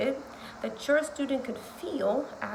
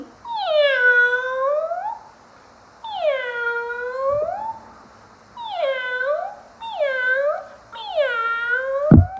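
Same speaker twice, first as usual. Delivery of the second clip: very high kitty mewing; cat meow; 13 semitones higher, 7 dB louder, much slower than usual. Shown as a demonstration. Teacher makes animal sounds for kiddos, high meows. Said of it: "I'm going to make animal sounds".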